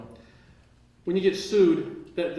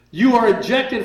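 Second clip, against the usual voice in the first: stern ruling; 7 semitones higher, 10 dB louder, slower than usual. Retold or imitated. Judge ejecting him, retold.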